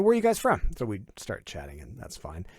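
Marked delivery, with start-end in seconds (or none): 0.0-0.6 rough guy asking. Retold or imitated. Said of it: "they turn around and go".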